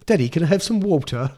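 a lightly voiced posh English kid accent in the style of Peppa Pig. Dad telling his mum how his daughter used to sound, Peppa Pig English accent. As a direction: mimic the Peppa Pig accent she picked up. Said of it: "speak properly".

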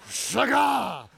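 shagah as a loud pouncing cry; shouted with attitude. Shown as a demonstration. Preacher to congregation, a fierce shagah shout. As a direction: roar shagah like a predator. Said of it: "say it like that with attitude".

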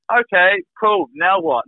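challenging reply. Retold or imitated. Retold reply after reaching the door.

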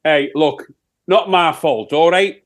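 put-on Sheffield accent. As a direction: answer as a broad Sheffield rock singer. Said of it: "it's my Sheffield accent".

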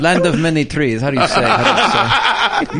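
put-on hammy Italian accent with laughs. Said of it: "Can you fake Italian accent".

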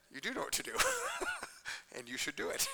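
soft words breaking into a laugh. Real, not acted. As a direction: chuckle through the friendly advice. Laughing through a friend's imagined reply.

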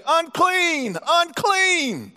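high mock cry of unclean; leper's call. Leper warning passersby, high mock cry. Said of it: "you gotta say".

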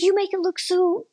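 imitating a high-pitched complaint; mock protest. Husband answering back to his wife, mock protest. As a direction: throw the voice high, mimicking his disbelief.

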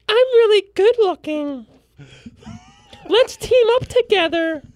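very high, slow mock girl voice; high girly voice. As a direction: squeak it like a cartoon girl. Voicing a character in parody trailer.

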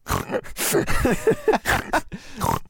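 high laugh full of snorts; snorting laugh. Acted. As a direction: snort while you laugh. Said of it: "a snorting laugh?".

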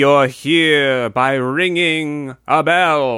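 slow, eerie fortune teller chant. Retold or imitated. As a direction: intone it slowly like a medium at a seance. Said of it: "She goes".

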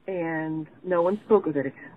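ordinary delivery

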